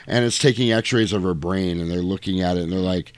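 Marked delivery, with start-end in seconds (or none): none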